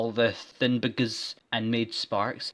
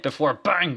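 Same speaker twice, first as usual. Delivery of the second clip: mock gunshot; 5 semitones higher, 6 dB louder than usual. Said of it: "sound effect".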